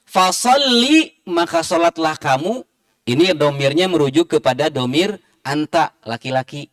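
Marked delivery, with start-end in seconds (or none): 0.1-1.1 "fasalli" chanted high and slow, with a short "li"; correct fasalli. Shown as a demonstration. Teacher stressing the right form, high. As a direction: sing out the correct ending. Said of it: "fatal juga maknanya".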